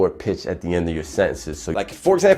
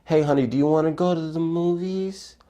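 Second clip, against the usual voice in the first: higher pitched, rising ending, seeking approval; approval-seeking rise; 7 semitones higher, 4 dB quieter than usual. Retold or imitated. Asking honey to the movies, higher, rising and unsure. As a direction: ask it up high like you need a yes. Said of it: "why does your voice do that".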